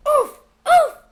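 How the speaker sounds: put-on loud high toddler bellow; bellowing baby. Baby Morgan bellowing in the crowd, loud and high. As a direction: bellow like an angry infant. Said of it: "Morgan bellowed".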